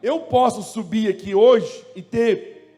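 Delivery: loud, high, slowing exhortation; forceful preaching. Real, not acted. Preacher urging the congregation, loud forceful exhortation. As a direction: press the point hard and loud.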